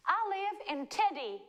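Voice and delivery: high put-on Southern accent; Southern American accent. Host trying out a wrong answer, Southern accent. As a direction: try the line as a Southern American. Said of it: "I was a Southern American accent".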